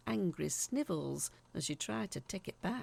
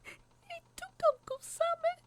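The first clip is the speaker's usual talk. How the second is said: put-on high little girl's voice, scared; a scared kid blurting it out; high, frightened child voice; 5 dB louder than usual. A narrator's reading. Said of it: "she mumbled out".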